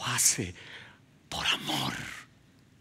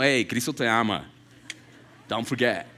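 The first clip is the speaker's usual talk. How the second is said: casual throwaway; 5 semitones lower than usual. Pretend.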